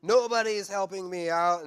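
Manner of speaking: self-pity. Retold or imitated. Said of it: "he moves into self-pity".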